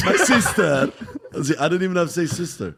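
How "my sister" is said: high, loud comic foreign-character voice; hammed-up catchphrase in a thick accent; Borat. Comic showing his sure-fire bit, Borat impression. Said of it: "I did Borat impression".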